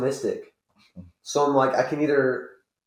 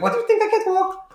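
put-on high, far old lady voice; grandma voice; 3 dB louder than usual. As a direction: wail like a frail old grandma. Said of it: "she has that grandma voice".

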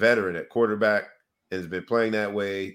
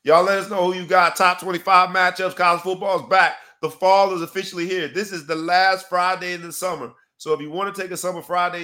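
loud, higher host sign-off; podcast outro read; 6 semitones higher, 5 dB louder than usual. Acted. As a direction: wrap the show like an announcer.

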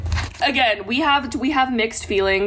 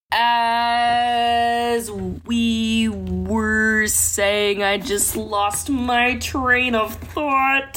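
high, drawn-out muppet frog voice; slow, stretched-out frog puppet delivery; Kermit; 5 semitones higher, much slower than usual. Pretend. Co-host losing her train of thought, in a Kermit voice. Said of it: "You sound like Kermit".

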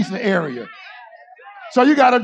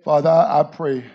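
much softer, lower prayer voice; 7 semitones lower, 6 dB quieter than usual. Acted. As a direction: pray quietly into the mic. Quiet prayer.

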